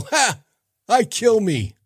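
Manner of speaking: high, cocky sitcom alien; Alf. Retold or imitated. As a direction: crack up at your own joke like a puppet alien. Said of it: "talking about Alf".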